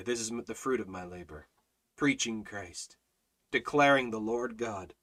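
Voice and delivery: low, soft earnest murmur; hushed conviction. Acted. Preacher quietly owning his calling, low and soft. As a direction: pull it down close and low.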